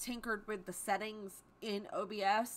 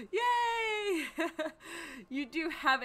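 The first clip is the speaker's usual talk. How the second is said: mock cheer; 6 semitones higher, much slower than usual. Real, not acted. Celebrating a quiet Friday night.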